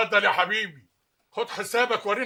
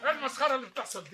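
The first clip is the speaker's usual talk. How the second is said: fast, demanding what this farce is; quick annoyed outburst; sharp rebuke; 6 semitones higher, 8 dB quieter, much faster than usual. Acted. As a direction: boss cutting in. Boss arrives at the quarrel.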